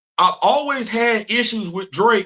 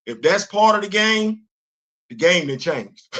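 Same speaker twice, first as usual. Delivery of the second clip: ordinary delivery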